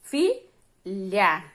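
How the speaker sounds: "filha" split in two, stress on "fi"; penultimate stress. Shown as a demonstration. Teacher giving a paroxítona example, "filha" broken into stressed syllables. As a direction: punch "fi", pause, then drop "lha". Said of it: "A sílaba tônica é a penúltima".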